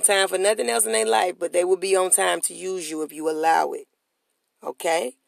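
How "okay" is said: usual speaking voice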